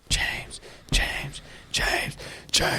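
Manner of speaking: hushed, whispered chant of the name James. Pretend. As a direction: whisper James again and again, spooky.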